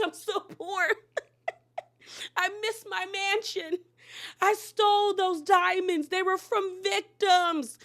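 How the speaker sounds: high-pitched, hammed-up sobbing wail; mock crying. Fan acting out a broke housewife to mock her, fake crying. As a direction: wail it out in badly faked tears. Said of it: "Then I would have started crying".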